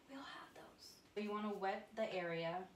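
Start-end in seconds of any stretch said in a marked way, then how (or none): 0.1-0.9 very soft, confiding whisper; whispered aside. Real, not acted. Admitting bad days to viewers, in a confiding whisper. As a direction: whisper it as a shared admission.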